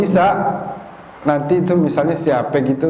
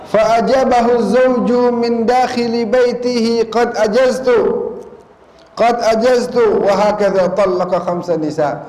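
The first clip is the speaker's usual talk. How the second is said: slow, higher Arabic recitation; 7 semitones higher, slower than usual. Acted. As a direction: intone the Arabic like a sermon. Reads Arabic text of story.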